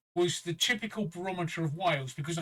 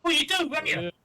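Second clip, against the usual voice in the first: cheeky shout; 10 semitones higher, 4 dB louder than usual. Real, not acted. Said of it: "Hell yeah".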